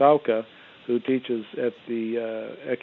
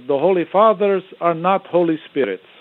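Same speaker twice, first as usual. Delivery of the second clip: higher, louder imitation of a Russian professor's accent; 6 semitones higher, 7 dB louder than usual. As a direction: deliver the punchline in a hinted Russian accent. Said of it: "He said in his Russian accent".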